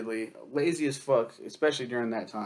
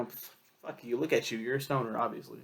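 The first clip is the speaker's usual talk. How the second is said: ordinary talk, nothing marked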